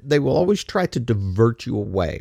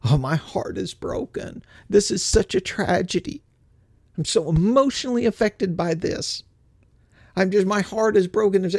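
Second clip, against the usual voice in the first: higher, mocking sob-story voice; sarcastic higher whine of fake grief; mock heartbreak; 8 semitones higher than usual. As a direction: parody a fake mourner's gushing. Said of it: "and they'll go on".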